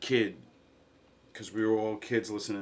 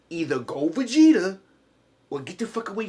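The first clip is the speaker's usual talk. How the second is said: sudden shout; 8 semitones higher, 10 dB louder than usual. Real, not acted. Ranting about father's advice.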